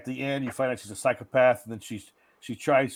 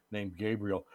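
usual speaking voice

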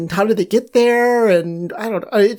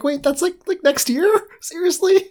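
mock disbelief; 10 semitones higher than usual. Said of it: "I'm like".

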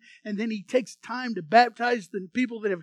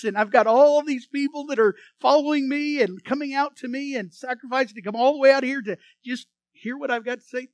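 louder, higher John calling over the crowd; 3 semitones higher, 7 dB louder than usual. Retold or imitated. John the Baptist calling out to the gathered crowd. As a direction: raise the voice to hold the crowd's attention.

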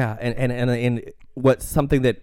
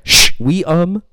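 mock French hush; 4 semitones higher, 8 dB louder, slower than usual. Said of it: "the French one is also like".

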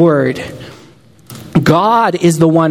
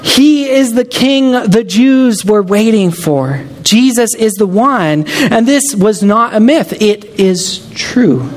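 higher, driving sermon climax; fervent preaching; 7 semitones higher than usual. Acted. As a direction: preach it with mounting conviction. Rising preaching about Jesus.

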